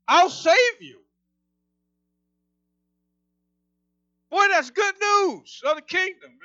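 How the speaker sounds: high, loud, rising excitement; excited preaching. Real, not acted. Preacher quoting Jesus as king and judge, excited. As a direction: preach it with your voice climbing. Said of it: "when I get excited, my voice goes up".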